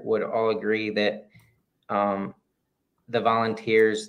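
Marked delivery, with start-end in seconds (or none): none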